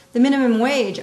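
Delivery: minimum wage punched high and loud; stress on minimum wage. Shown as a demonstration. Teacher stressing the phrase for students, loud emphasis. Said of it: "focus on the word minimum wage".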